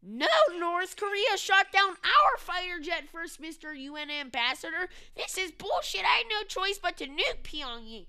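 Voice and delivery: loud, high, outraged mock-presidential rant; hammed-up indignant yelling; hysterical president impression. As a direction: shout it like a hotheaded leader justifying himself. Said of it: "imagine if this guy was president".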